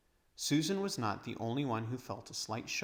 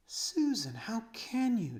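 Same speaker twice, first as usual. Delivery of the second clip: high, breathless reproach; Lucy scolding her sister; shocked girl, hushed and reproachful; 11 semitones higher, 3 dB louder, slower than usual. Acted. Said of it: "said Lucy with a reproachful glance".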